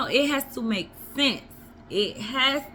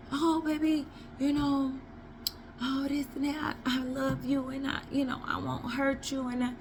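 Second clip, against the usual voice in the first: sweet-talking man; 3 semitones higher, 8 dB quieter than usual. Retold or imitated.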